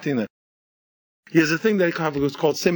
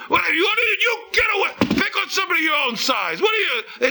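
high, strained squeaky yelling; outraged mouse; 13 semitones higher than usual. Acted. Mouse chasing off someone on the lion, squeaky fury. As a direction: scold the bully in a tiny frantic shriek. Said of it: "you're a mouse".

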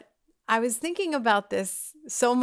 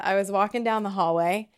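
ordinary talk

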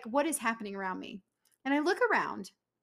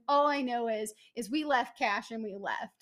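ordinary talk

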